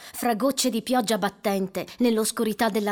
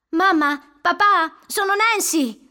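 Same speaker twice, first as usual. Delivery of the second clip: higher, louder call; 9 semitones higher, 8 dB louder, slower than usual. Acted. Nancy calls out loudly to her parents. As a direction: call up to parents through the house.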